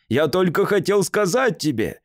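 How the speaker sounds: ordinary delivery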